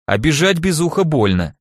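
ordinary delivery

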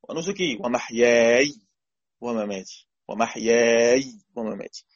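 ومحياي held as wemaħyāy with a long madd before the still yā; slow recitation stretching ومحياي; sukun reading. Shown as a demonstration. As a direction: chant ومحياي with silent final yā. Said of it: "يقرأ بالسكون".

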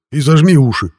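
high, loud urgent call; loud warning. A narrator's reading. The hero shouts to grandpa to cover his ears. Warns grandpa before shooting.

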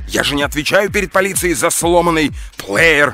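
loud, high, indignant tirade; enraged shouting. A narrator's reading. Эдуард argues he owes police nothing, shouting enraged. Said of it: "закричал взбешённый Эдуард".

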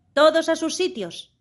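a high, loud command; thundering shout. A narrator's reading. Queen orders everyone to their places, thundering. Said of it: "gritó la reina con voz de trueno".

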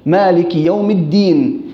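"الدين" slowed with a clear d; drawn-out dal in "الدين"; correct dal. Shown as a demonstration. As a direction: slowly say "الدين" as ad-diin. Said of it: "هاي هنا غلط بيكون".

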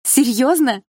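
high, loud, amazed question; delighted exclamation. A narrator's reading. Narrator hears friend will take the cat, amazed exclamation. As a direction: exclaim it in happy disbelief. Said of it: "воскликнула я".

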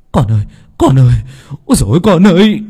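loud, high cries while running; grieving shout. Acted. Father running to the crash, shouting for his son. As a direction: shout for the son through grief. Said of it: "Anh vừa chạy vừa hét".

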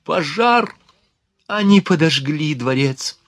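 high, choked cry of alarm; stifled cry. A narrator's reading. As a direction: cry out in alarm but hold it back. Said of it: "при сдавленном крике Луизы Фюзиль".

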